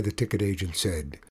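usual speaking voice

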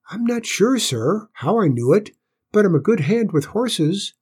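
put-on high, clear little boy's voice; squeaky, high-pitched youngster being polite. A narrator's reading. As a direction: answer the boss in a small squeaky pipe. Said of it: "in a clear but squeaky voice".

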